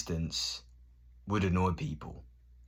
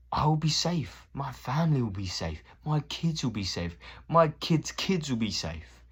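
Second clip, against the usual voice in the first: higher, building insistence, slowing on the last line; fervent vow; 8 semitones higher, 3 dB louder than usual. Real, not acted. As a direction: promise it with rising conviction. Emphatic vow about future safety.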